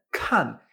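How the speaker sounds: kan with released air and a falling tone; aspirated K. Shown as a demonstration. Teacher asking if air is noticed, kan aspirated and falling. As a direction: puff air on kan, let the pitch fall. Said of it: "¿Notas que sale aire?".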